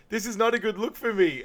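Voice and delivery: high-pitched mock whine; put-on high complaint; imitated dismay. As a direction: lament your looks for laughs. Acting out waxed man's dismay.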